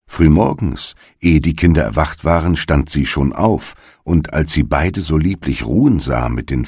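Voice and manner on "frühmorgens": plain reading voice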